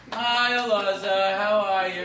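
slow, loud group greeting in unison style; drawn-out mock welcome from a support group; support group chorus.

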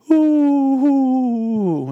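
long, high, loud mock "ooh"; imitated gushing coo. Imitating women shown a picture of a baby, gushing coo. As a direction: coo in a drawn-out exaggerated squeal, sliding down.

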